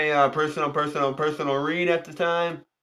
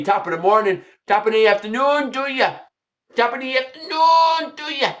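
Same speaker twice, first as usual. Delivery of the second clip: Irish greeting; 7 semitones higher than usual. Pretend. Saint Patrick's Day Irish greeting.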